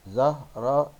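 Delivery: "زهرة" broken into "zah-ra-tun"; tanween damma ending. Shown as a demonstration. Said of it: "وانطق التنوين بالضم".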